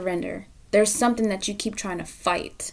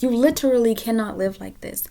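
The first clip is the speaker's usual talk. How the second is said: ordinary talk, nothing marked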